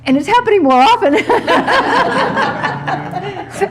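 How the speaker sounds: high, quick, laughing delivery. Real, not acted. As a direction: blurt it out through laughter. Laughing at own forgetfulness.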